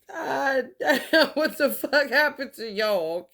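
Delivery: amused, laughing outburst; laughing disbelief. Acted. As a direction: exclaim it while cracking up. Acted reaction, laughing through it.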